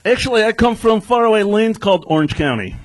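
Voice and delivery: mock-Russian stand-up character; fake Russian accent. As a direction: keep up the put-on Russian accent. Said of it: "so what's your real accent?".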